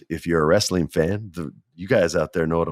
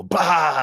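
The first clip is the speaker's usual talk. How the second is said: impact noise; 9 semitones higher than usual. Retold or imitated. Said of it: "my back's supposed to go".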